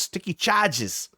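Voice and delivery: slow, high put-on Australian accent; hammy, drawn-out Australian accent. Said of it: "That was a terrible Australian accent".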